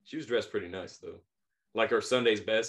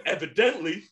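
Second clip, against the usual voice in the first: higher, louder sassy snap; lady's sassy comeback; 8 semitones higher, 5 dB louder than usual. Retold or imitated. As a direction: deliver the comeback with attitude.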